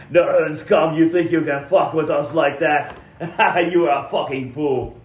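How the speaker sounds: mocking bad-guy voice; scornful enemy trooper. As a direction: sneer at a feeble trick between laughs. Enemy mocks the spider illusion.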